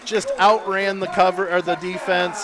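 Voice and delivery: loud touchdown call, stretched out at the score; excited play-by-play. Real, not acted.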